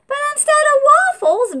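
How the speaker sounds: high, quick and loud; squeaky fast refrain. A narrator's reading. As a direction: zip through it way up high. Narrator teases the repeated refrain.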